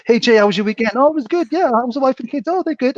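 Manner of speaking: high-pitched imitated chit-chat; mock small talk. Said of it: "I'd just talk to you".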